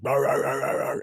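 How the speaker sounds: rapid mock barks of a frantic dog; dog going nuts at the door, imitated; imitated dog barking. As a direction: bark like a dog going ballistic. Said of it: "my dog at the time that I had was going fucking ballistic".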